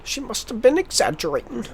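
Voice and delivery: teasing imitation; mimicking film line. Mimics the film line just heard.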